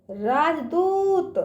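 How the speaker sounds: slow राजदूत, दू held long; drawn-out long ū. Shown as a demonstration. Teacher drilling children, राजदूत with drawn-out ū. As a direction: stretch the ū in राजदूत. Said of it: "इसका उच्चारण ना खींच के करते हैं".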